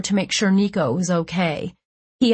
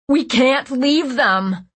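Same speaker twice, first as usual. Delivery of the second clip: high, slow, raised cry; 6 semitones higher, 3 dB louder, much slower than usual. Acted. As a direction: cry it out in protest. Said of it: "Jude cried".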